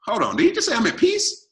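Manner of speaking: can't believe what he just heard; hearing his injured friend say he is at peace, incredulous; stunned disbelief. Retold or imitated. Shocked at friend's words.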